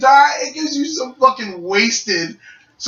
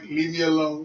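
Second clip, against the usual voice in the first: tired brush-off; 5 semitones lower, 7 dB quieter than usual. Retold or imitated. Tired retort to brother's teasing.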